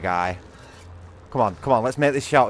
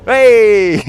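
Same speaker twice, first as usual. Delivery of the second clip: much higher, louder victory yell; triumphant shout; 11 semitones higher, 12 dB louder than usual. Real, not acted. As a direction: shout in delight at the kill. Finally kills the Ubermorph.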